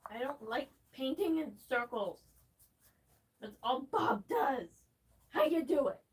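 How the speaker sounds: venting loudly at a painting show host; yelling at Bob Ross. Real, not acted. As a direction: shout your complaint at the painter on screen. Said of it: "Why am I yelling at Bob Ross?".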